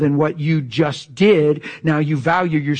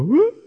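joyful whoop; 13 semitones higher, 4 dB louder than usual. Retold or imitated. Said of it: "And they go".

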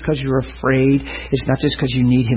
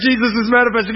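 normal talking voice

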